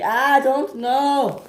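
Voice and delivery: high, slow odd squeaky take on "I don't know"; playful odd voice; high silly voice. A narrator's reading. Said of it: "make your voice do that?".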